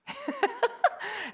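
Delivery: a bright, high burst of laughter. Real, not acted. Mom laughing at her kids' dismissive reply: high laugh. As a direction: laugh it off, amused.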